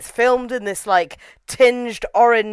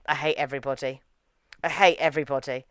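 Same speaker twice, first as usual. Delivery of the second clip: low, quiet grumble of disgust; deadpan loathing; 9 semitones lower, 8 dB quieter, faster than usual. Retold or imitated. Viewer fed up with the film's characters, low deadpan. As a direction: sink into a soft, low deadpan.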